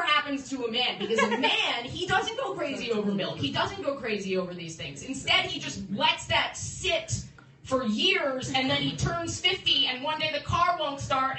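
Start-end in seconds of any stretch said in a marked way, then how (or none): none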